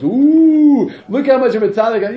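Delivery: high, loud ooh of mock piety; mocking self-righteous man. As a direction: ham up a pompous holy man. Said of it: "The Gemara says".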